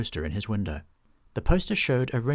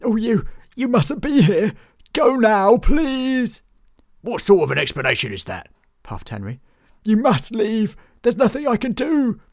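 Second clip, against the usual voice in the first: much higher, louder frightened pleading; panicked bard; 12 semitones higher, 9 dB louder than usual. Acted. Bartholomew begging his friends to leave, high panicked voice. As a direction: plead with them to flee, panicking. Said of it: "face was glazed with panic".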